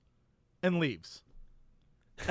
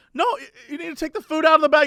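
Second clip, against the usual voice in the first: put-on quick, high lady with a slight German accent; slight German accent, fast and higher; 7 semitones higher, much faster than usual. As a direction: lightly imitate a German woman giving instructions, pitched up. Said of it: "sort of has a German accent".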